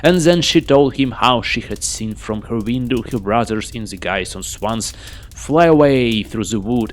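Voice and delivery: ordinary delivery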